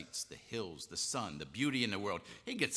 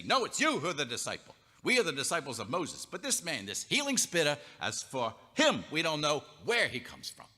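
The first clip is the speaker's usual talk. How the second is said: indignant rebuke; 5 semitones higher, 5 dB louder than usual. Acted. Pharisees harangue the man.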